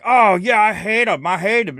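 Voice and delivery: hammed-up eager fan, loud and high; mock agreement. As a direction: cheer along like a zealous fan. Mocking an agreeing fanboy.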